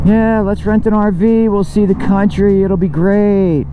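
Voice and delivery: mock excited voice. Mocking an RV enthusiast.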